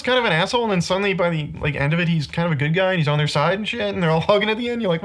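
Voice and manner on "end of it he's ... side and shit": ordinary delivery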